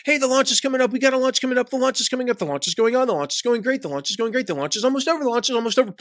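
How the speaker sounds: high-pitched, breathless-sounding rattle of hype; mock launch hype. Marketer pesters affiliates during a launch, mock excited hype.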